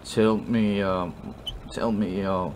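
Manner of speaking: put-on slurred, rambling drunk; sloppy drunk apology. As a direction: stumble tipsily and drag out the last words. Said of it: "what it sounds like when you're drunk".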